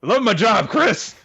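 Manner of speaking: high, loud sarcastic outburst; hammed-up high-pitched holler; mock enthusiasm. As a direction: shout it with overdone cheer at your co-host.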